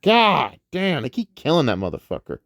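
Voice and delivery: ordinary talk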